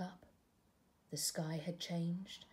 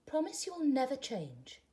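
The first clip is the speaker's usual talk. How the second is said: caterpillar pleading; 7 semitones higher, 3 dB louder than usual. Acted. Caterpillar asks a promise.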